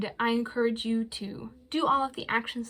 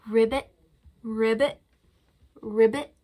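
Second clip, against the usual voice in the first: louder croaking ribbit; frog croak; 4 dB louder than usual. Pretend. Librarian imitating a frog for kids, croaking. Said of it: "Hear its funny sound".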